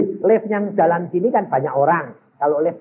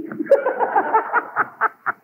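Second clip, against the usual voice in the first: breathy high laugh; laughing at the kneeling parents. Real, not acted. Preacher laughs recalling his parents praying.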